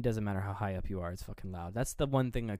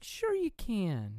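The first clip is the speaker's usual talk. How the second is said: put-on high, slow child's yes; lightly voiced cooperative kid; eager little kid; 9 semitones higher, much slower than usual. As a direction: chirp it out like an eager little boy. Child Andy voiced in retelling.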